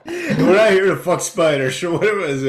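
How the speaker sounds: high, loud hammed-up Aussie line; mock Australian accent. Podcast host trying Aussie slang, high and loud mock accent. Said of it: "the Australian accent".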